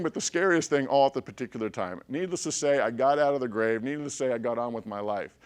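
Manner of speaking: ordinary talk, nothing marked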